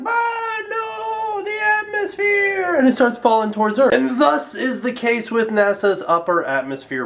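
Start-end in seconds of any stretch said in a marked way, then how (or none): 0.0-2.7 high, hammed-up wailing cry of doom; mock wail of despair. The dying satellite screams as it falls, with a hammed-up wail. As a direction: cry out like a doomed thing, dragging out "the atmosphere". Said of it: "it's like".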